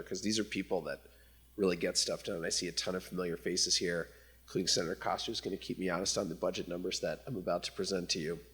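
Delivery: ordinary delivery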